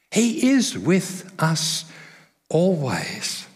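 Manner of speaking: slow, drawn out with stress on is; slow affirmation. Real, not acted. Pastor assures the congregation, slow and deliberate. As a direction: let each word land slowly. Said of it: "slow climactic affirmation".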